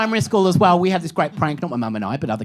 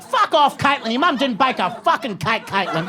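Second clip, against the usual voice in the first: put-on high-pitched childish outrage, falling by the end; hammed-up playground argument; mock indignation; 6 semitones higher than usual. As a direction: squawk back in mock disbelief. Quarrel replayed, escalating child outrage.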